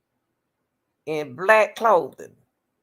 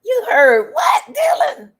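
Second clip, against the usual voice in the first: high, loud incredulous outburst; stunned, high-pitched questioning yell; shocked disbelief; 8 dB louder, slower than usual. Real, not acted. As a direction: shriek it in disbelief at what was heard. Shocked reaction to Dylan's statement.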